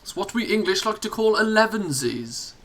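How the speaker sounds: high, plummy posh English voice. Pretend. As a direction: put on a posh English accent. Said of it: "That was my posh voice".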